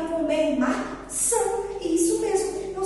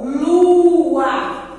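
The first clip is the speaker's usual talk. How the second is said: "Lua" stretched out, much louder; reading by syllables; 2 semitones higher, 9 dB louder than usual. Shown as a demonstration. Teacher showing which word was formed, drawn out. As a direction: hold the first vowel of "Lua" long and loud. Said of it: "Que palavra formou?".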